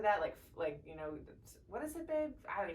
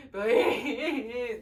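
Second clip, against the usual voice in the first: loud, higher mock sob; retold crying plea; 4 semitones higher, 8 dB louder than usual. Mother reenacts begging for epidural, exaggerated tearful wail. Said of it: "I cried. I was like".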